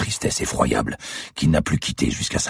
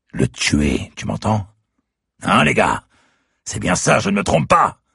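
higher and louder, building to a shout; rising fury; 10 semitones higher, 4 dB louder than usual. Acted. Killer turns on the captives, rising angry shout. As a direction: rage at the bound brothers.

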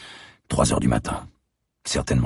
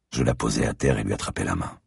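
usual speaking voice

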